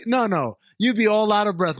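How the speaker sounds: ordinary delivery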